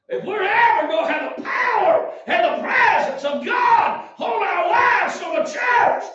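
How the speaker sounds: high, emphatic revival preacher; full-force preaching. Acted. Preacher to church on old timers' prayers, full-force preaching.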